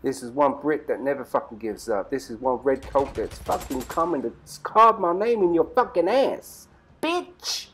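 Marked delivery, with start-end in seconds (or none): 2.1-6.7 building taunt. Real, not acted. Taunting enemies in gunfight. 7.0-7.7 very high sudden yelp; shrieked insult. Real, not acted. Shooting an enemy.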